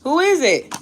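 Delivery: loud high call through the house; high shouted who is it. Real, not acted. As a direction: call out to whoever's at the door. Calling out to someone at the door.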